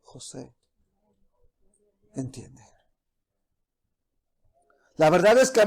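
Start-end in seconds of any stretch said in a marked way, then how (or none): 0.0-2.6 casi un susurro, mucho más bajo; llama con dulzura, apenas audible; voz suave de Dios. Acted. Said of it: "ayúdame a entender a tu voz suave". (susurro tierno y bajo)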